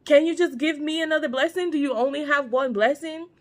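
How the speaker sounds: mock tearful plea, pitched high; upset pleading. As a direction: imitate a hurt son begging, without real sobs. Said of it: "Esau is mad and he's crying".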